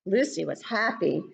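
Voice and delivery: ordinary delivery, nothing marked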